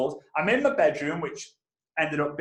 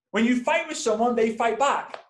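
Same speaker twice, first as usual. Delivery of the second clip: ordinary talk, nothing marked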